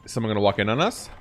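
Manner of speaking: usual speaking voice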